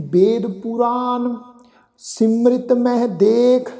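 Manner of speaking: slow, measured recitation of sacred verse; slow scripture recitation. Acted. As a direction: lay each phrase down slowly with pauses. Recites gurbani verse slowly.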